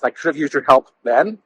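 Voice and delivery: usual speaking voice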